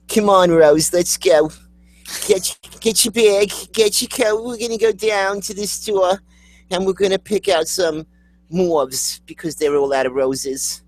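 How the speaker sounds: high-pitched, hammed-up New York accent; mock New Yorker calling a companion along; bad New York accent. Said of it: "somebody with a really bad New York accent".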